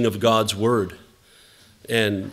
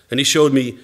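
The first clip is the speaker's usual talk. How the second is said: normal talking voice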